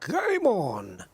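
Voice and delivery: darts commentator. Retold or imitated. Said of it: "I sounded like that darts, the darts commentator".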